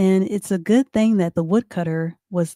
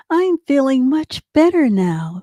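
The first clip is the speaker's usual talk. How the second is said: lightly voiced, higher grandmother; a hint of relieved storybook granny; grandmother character; 6 semitones higher than usual.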